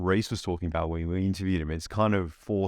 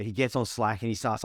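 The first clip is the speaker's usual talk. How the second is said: ordinary talk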